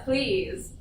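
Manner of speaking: normal talking voice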